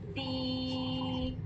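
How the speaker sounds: soft दी held long on one note; held vowel. Shown as a demonstration. Teacher showing the lengthened letter, soft held vowel. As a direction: stretch दी softly and steadily.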